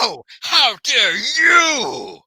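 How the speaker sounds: hammed-up tearful outrage; mock crying. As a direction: wail it like an offended child. Escalating duel, fake crying.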